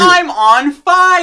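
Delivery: high, drawn-out mock scream; hammy slow on-fire scream; burning scream. Echoing the burning kid.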